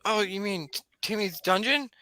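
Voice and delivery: put-on high-pitched enthusiastic kid gamer; Tony, eager. Game master as Tony offering to introduce his DM, eager.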